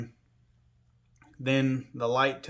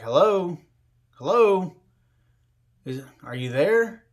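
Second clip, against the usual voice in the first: raised call of hello into the hallway; 4 semitones higher, 4 dB louder than usual. Retold or imitated. Patient in bed calling the nurse outside his door, calling out loud. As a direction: call out loud, unsure anyone's there.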